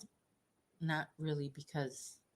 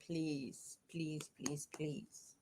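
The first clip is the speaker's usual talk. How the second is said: pleading whisper; 8 dB quieter, much slower than usual. Real, not acted. Begging dog to get off.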